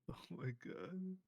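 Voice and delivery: slow, high laughing gasp; horrified amusement. Real, not acted. Laughing at grim joke.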